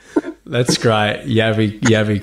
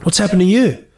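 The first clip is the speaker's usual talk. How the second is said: quoted script line; 6 semitones higher than usual. Retold or imitated. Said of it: "his line in the script was".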